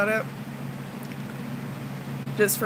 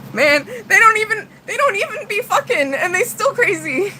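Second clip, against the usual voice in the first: exasperated; 8 semitones higher than usual. Real, not acted. Exasperated complaint.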